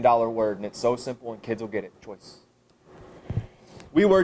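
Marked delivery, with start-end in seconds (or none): 2.0-2.4 Choice almost breathed, much softer; hushed final Choice; quiet emphasis. Shown as a demonstration. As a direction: drop Choice to a hush. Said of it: "that one word again I emphasized".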